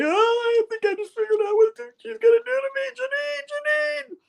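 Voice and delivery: very high squealing mock panic; hammed-up frantic falsetto; freaking out. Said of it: "he's all freaking out".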